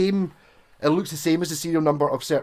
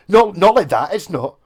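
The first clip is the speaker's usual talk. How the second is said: loud mock telling-off; mock scolding; 4 semitones higher, 8 dB louder than usual. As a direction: scold like a snotty forum regular. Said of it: "getting scolded".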